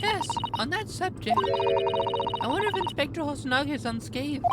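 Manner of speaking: put-on bright high polite young woman. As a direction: lift the voice way up, sweet and proper. Voices Susato again.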